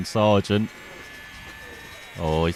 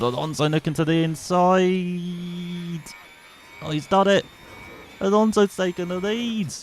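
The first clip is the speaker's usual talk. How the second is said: much higher, thrilled lead-change call; excited commentary; 7 semitones higher than usual. Real, not acted. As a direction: sell the overtake for the lead. Lead change called live.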